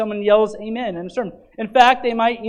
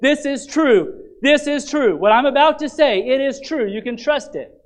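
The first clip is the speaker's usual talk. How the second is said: higher, louder, earnest declaring; emphatic affirmation; 6 semitones higher, 4 dB louder than usual. Shown as a demonstration. Preacher showing what amen means, higher and louder with conviction. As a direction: declare it like a preacher sure of his word. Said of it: "you can say it like this".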